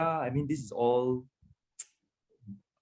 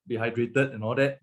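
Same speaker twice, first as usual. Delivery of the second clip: usual speaking voice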